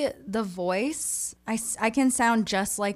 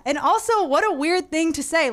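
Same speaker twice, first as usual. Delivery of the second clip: usual speaking voice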